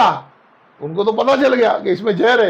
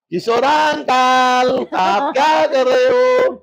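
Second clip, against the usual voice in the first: drawn-out sing-song words, louder; slow sing-song call; 4 dB louder, much slower than usual. Retold or imitated. Boy greeting uncle Kishor in a slow sing-song. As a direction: stretch each word slowly in a tune.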